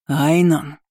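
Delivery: understated angry roar. A narrator's reading. Guard captain bursting in on the steward, mildly roaring. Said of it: "взревел Карл".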